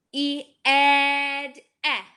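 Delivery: "E, ed" loud and stretched; teacher extends a vowel for kids; extended E sound. Shown as a demonstration. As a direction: draw out the short e. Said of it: "doesn't match that ah sound".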